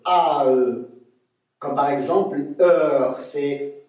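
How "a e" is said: "A E" said slowly as two joined vowels, falling; Québécois diphthong. Shown as a demonstration. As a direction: draw out "A E" as a gliding ah-eh. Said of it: "Le son E se prononce A E".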